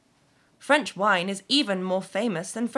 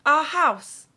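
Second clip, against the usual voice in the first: high, louder cry of discovery; excited exclamation; 7 semitones higher, 5 dB louder than usual. A narrator's reading. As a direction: announce it excitedly, pitched up. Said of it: "s'écria soudain Alison".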